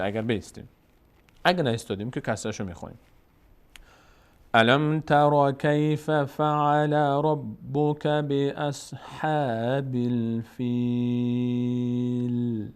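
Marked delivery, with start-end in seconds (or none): 4.5-12.7 slow chanted verse; Quran recitation. Acted. (تلاوت آهسته آیه)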